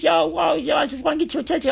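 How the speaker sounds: very high, loud, fast holler; attention-getting shout. Retold or imitated. Prisoner explains he just wants attention, high imitated shouting.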